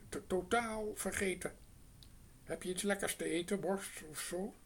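a sly crow asking for sausage. A narrator's reading. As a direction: play the hungry crow dodging questions. Narrator voices the crow.

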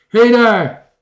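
high, loud shout; calling out. A narrator's reading. Strange man startles visitors by the campfire, shouting. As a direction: holler at intruders. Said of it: "riep de vreemde man".

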